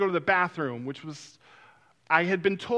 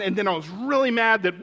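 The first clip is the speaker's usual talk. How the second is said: ordinary delivery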